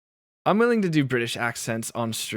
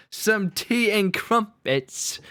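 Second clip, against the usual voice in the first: mock British accent; 9 semitones higher, 4 dB louder, much slower than usual. Streamer teasing British viewers between laughs, mock British accent. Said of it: "stereotypical that you guys pronounce".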